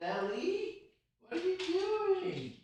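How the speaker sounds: very high sing-song voice for the dog, called from across the room; high-pitched pet talk to the dog away from the mic. Real, not acted. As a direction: coo at a naughty puppy, pitch way up. Streamer talking to his dog.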